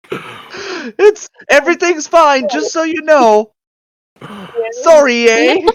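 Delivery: high, put-on Canadian guard voice; apologetic fake Canadian accent. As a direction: reassure in a hammed-up Canadian accent. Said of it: "I have a Canadian accent".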